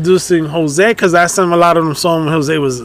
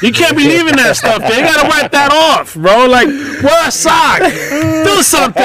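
mock fatherly lecture building to a shout; rising mock lecture; 6 semitones higher than usual. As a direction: pitch climbing into an exasperated half-shout. Dad lecturing son, rising emphatic.

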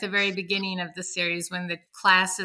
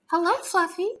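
sweet teacher voice; 9 semitones higher than usual. Acted. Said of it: "make Miss Day's voice so sweet".

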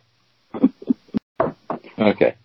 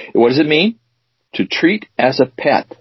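normal talking voice

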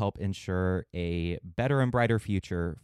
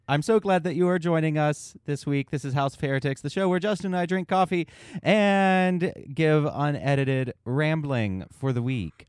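podcast host intro; 6 semitones higher than usual. Acted.

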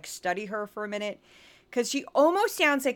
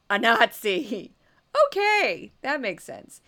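mock disbelief; 5 dB louder, slower than usual.

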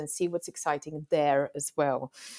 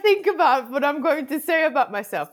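giggling through the words, pitched up and louder; laughing; 9 semitones higher, 6 dB louder than usual. Real, not acted. Guest laughing as she's asked to introduce herself, nervous giggly voice. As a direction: laugh nervously while starting to talk about yourself.

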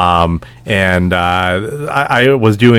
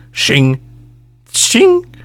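shing then shing again, rising very high; blade slash; 12 semitones higher than usual. Shown as a demonstration. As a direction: ring out shing like a drawn blade. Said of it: "too high pitched for a shing".

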